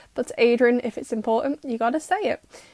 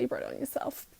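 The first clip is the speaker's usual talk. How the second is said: softer muttered aside; muttered repeat; 15 dB quieter than usual. Real, not acted. Telling viewers it's their fault, muttered under her breath. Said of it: "So you brought it on yourself".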